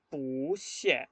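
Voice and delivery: "pu xie" with long rising "pu", falling "xie"; second-tone pu. Shown as a demonstration. Teacher giving the tone answer, "pu xie" with rising pu. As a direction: stretch and lift "pu", drop "xie". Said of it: "Qué tono pusieron".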